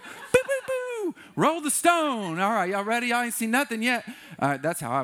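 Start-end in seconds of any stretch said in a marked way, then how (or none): 0.0-1.1 mock fireworks. 1.3-4.0 grand showy order to the crowd; hammed-up showman. Preacher mocks himself showing off at the tomb, loud and high.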